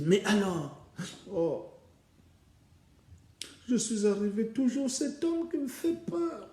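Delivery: high whiny sister's voice; fretful sister. Acted. Sister reporting the failure to her sister, high and whiny. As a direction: complain that the plan failed. Sisters talk after failed visit.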